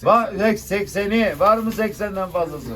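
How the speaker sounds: loud auction call; auctioneer. Real, not acted. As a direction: call the lot to the room. Calling rising bids.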